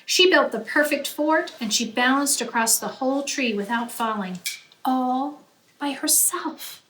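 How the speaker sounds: ordinary delivery, nothing marked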